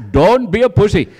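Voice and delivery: put-on loud doctor with an Indian accent. Indian doctor scolding a noisy patient, Indian accent. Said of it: "He was from India".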